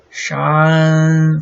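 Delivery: 山 held long, loud, high and level; first tone. Shown as a demonstration. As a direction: stretch 山 on one high pitch. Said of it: "first tone".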